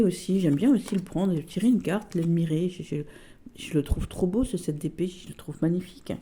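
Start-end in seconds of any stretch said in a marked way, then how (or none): none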